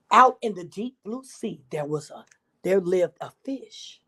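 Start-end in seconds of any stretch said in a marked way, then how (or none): none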